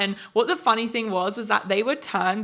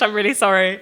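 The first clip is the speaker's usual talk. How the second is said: normal talking voice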